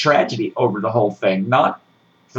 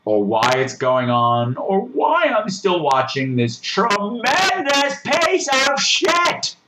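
exasperated viewer; slower than usual. Retold or imitated. Reviewer quoting himself while watching, slow exasperated whine. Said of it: "I'm going".

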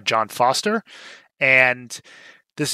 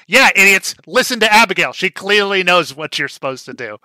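much louder and higher, gloating at the others; mock yell; 6 semitones higher, 7 dB louder than usual. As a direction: rub it in their faces, shouting. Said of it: "It was like".

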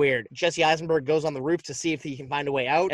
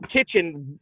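ordinary delivery

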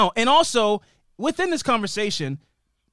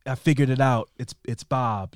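grave Mitch; 6 semitones lower than usual. Retold or imitated. Imitating Mitch again.

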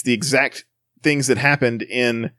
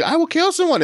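mock screaming. Imitating him as the others keep laughing, mock screaming. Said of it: "he's, like, screaming out and going".

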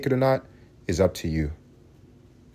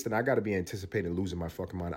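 ordinary talk

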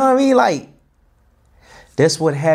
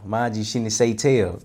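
usual speaking voice

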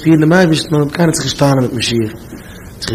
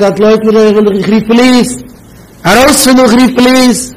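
high, raised-pitch mock shout; mock yelling; 5 semitones higher than usual. Objector threatening to call the police, mock yelling. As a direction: act out someone yelling a threat. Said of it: "und einer schreit".

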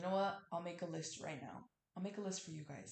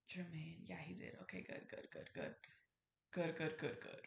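softer, whispered okay, good; whispered aside; 2 semitones lower, 5 dB quieter than usual. Real, not acted. Whispering aside to Jermaine while checking a fact, barely voiced. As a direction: murmur it off mic to a friend.

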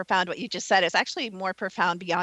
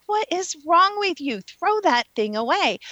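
incredulous, higher and pushing; exasperated; 8 semitones higher than usual. Retold or imitated. Scolding herself over an old ex's cards, exasperated. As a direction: scold yourself for keeping the cards. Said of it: "I'm like".

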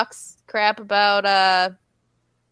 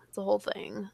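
a breathy unvoiced aside; whispered aside; 12 dB quieter than usual. Real, not acted. Podcaster tells listeners about the negotiations, whispering. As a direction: drop to a secret whisper.

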